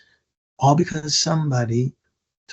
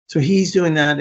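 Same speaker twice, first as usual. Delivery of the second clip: ordinary talk, nothing marked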